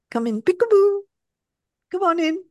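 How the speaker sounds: high peekaboo voice; playful coaxing. Acted. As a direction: coax someone in as in a child's game. Playful invitation, like to a child.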